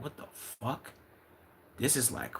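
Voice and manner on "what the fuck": breathless whispered disbelief; stunned whisper. Retold or imitated. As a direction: whisper it, floored by what you see. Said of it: "I be like".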